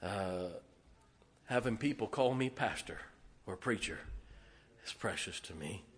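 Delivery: real emotion, voice dropping; holding back tears. Real, not acted. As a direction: fight back the tears quietly. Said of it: "I'm gonna try not to cry here".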